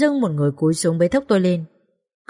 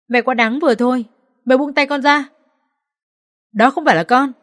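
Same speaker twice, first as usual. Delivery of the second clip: higher, louder pleading anger; desperate angry protest; 6 semitones higher, 3 dB louder than usual. Acted. Daughter-in-law being dragged, shouting back in desperation. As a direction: push back against her in panic. Wife fights back, held.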